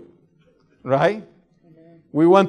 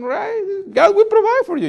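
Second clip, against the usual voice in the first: much higher, sing-song mockery of blind faith; mock naive believer; 4 dB louder than usual. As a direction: squeak it out like a naive believer, louder on the end. Mocking the carefree believer.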